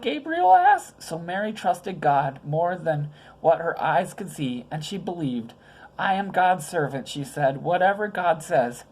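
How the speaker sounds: gentle storyteller voice for little ones; story reading to children. A narrator's reading. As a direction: read it like a bedtime Bible story. Reads Christmas story to children.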